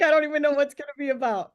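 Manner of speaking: quick, high, giggly; laughing aside. Retold or imitated. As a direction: rush it out amused. Laughing self-talk.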